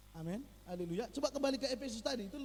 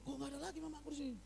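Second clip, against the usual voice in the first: put-on confused child; 3 semitones higher, much faster than usual. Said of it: "dia bingung".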